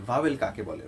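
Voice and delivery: ordinary talk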